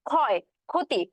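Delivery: ক্ষয় said as খয়, ক্ষতি said as খতি; pronunciation demo. Shown as a demonstration. Teacher showing students how ক্ষয় and ক্ষতি sound. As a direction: initial ক্ষ sounded as a plain খ. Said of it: "আমি মুখে কিভাবে উচ্চারণ করতেছি".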